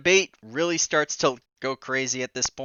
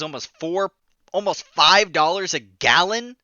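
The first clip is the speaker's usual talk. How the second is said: outraged rant; 6 semitones higher, 5 dB louder than usual. Real, not acted.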